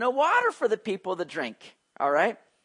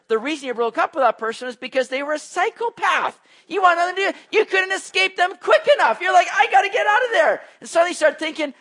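louder and higher, building to a passionate peak; preaching at full force; 10 semitones higher, 7 dB louder than usual. Real, not acted. Preacher warning his congregation about old relationships, loud and fervent. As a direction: drive it home like a fiery preacher.

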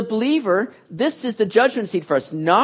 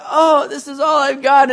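higher, louder mock dismay; bummed out; 6 semitones higher, 4 dB louder than usual. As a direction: play yourself crushed by a paper chain. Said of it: "And I'm like".

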